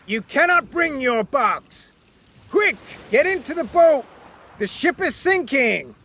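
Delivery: high, insistent shouted orders; urgent shouting. Acted. Captain insisting Wyatt leave the box, high shout. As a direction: press him to hurry. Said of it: "shouted the captain".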